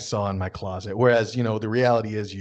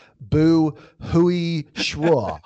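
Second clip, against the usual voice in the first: very slow, higher, clumsy foreigner's Mandarin; bad beginner's Chinese; 4 semitones higher, 3 dB louder, much slower than usual. Shown as a demonstration. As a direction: drag out each syllable in exaggerated bad Mandarin. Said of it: "you should say, like".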